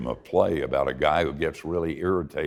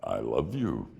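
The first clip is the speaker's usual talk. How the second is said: God answers the dancing Gideon, low and gentle; God's voice; 5 semitones lower, slower than usual. Acted. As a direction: speak like a patient, loving God. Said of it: "And God says".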